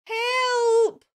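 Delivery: high, loud cry of help from the tree; Kitty's high shout for help. Acted. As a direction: yelp for help, stuck and scared.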